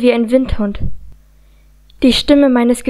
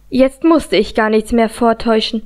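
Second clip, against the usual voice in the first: plain reading voice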